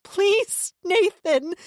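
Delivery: high, loud tearful begging; sobbing plea. A narrator's reading. Sister sobbing, begging her brother. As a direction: beg through sobs. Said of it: "Anna sobbed".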